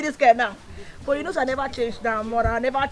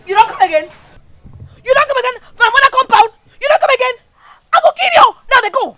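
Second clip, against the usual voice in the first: furious screaming; 16 dB louder, faster than usual. Acted. Daughter screams threats at friend.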